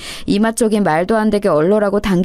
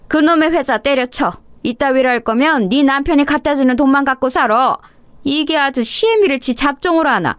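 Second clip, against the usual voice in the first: scolding, higher-pitched mother-in-law; angry mother-in-law; 3 semitones higher than usual. Acted. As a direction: tell off the daughter-in-law in an indignant, raised pitch. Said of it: "노려보면서 대뜸 거기 있어보라고 소리를 지르시는". (화난 시어머니의 호통)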